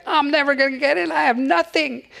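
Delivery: high, fast panicky whine; mock panic, rushing the words; freaking out. Third guy at heaven's gate, panicking, high and fast. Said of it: "The third guy's freaking out".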